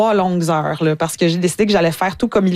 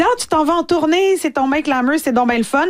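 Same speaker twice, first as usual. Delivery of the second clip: gushing friends; 7 semitones higher than usual. Retold or imitated. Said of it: "tout le monde me dit".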